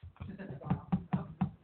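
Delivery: soft unvoiced giggle; hushed little laugh; breathy giggle. Real, not acted. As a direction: let a quiet giggle slip out. Giggles at her own sweetness.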